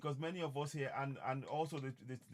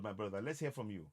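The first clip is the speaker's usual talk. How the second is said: usual speaking voice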